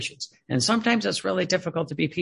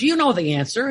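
impatient priest; 6 semitones higher, 4 dB louder than usual. Retold or imitated. Retold priest scolding the speaker.

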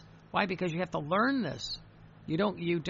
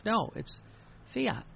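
"fiat" sung out high and short; emphatic fiat; 6 semitones higher, slower than usual. Shown as a demonstration. Preacher teaching the faithful to say fiat, bright high stress. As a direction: lift "fiat" up like a joyful answer. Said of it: "You learn how to say fiat".

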